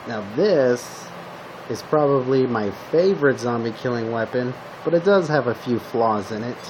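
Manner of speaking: normal talking voice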